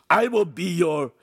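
high, loud, cut-off outburst; forceful angry claim; furious declaration. A narrator's reading. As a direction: insist in rage until interrupted. Enraged man cut off mid-threat.

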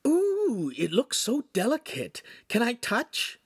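dripping sarcasm. A narrator's reading. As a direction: fake awe to mock the master. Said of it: "with dripping sarcasm".

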